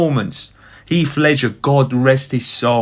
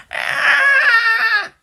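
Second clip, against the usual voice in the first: high screeching dino cry, imitated; dinosaur roar. Recalling the Jurassic Park scene, imitating the dinosaur roar. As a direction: shriek like the T-rex. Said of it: "the dinosaur goes out and he goes".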